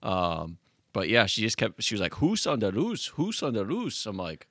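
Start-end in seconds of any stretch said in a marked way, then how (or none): 2.1-4.1 imitating a French Canadian woman, chanting it higher; a light French Canadian accent on a sing-song repeat; mock French Canadian accent. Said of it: "thickest French Canadian accent".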